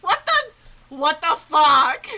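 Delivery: high, slow, loud disbelief; stunned disbelief. Real, not acted. Gamer stunned by the twist, slow shocked disbelief. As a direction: drag out each word in shock.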